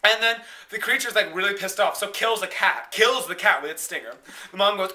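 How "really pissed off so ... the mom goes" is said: ordinary delivery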